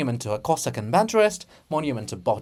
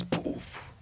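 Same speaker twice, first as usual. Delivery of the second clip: soft whispered poof; 10 dB quieter than usual. Acted. Distant muffled sound.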